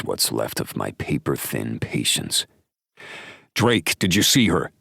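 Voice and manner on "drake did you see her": higher, urgent male voice; gruff friend voice. Acted. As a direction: press him for an answer. Drake voiced by narrator.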